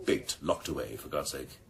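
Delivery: normal talking voice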